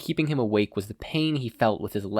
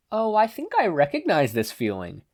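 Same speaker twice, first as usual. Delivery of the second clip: higher, knowing tease; teasing; 8 semitones higher than usual. Acted. Conscience teasing Katsuki, sing-song tease. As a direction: needle him playfully. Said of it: "in a teasing voice".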